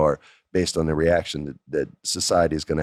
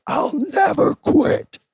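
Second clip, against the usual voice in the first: high, slow, loud vow; defiant shout; 5 dB louder, much slower than usual. Retold or imitated. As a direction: shout it drawn out like a final promo line. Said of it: "finishes his promo with".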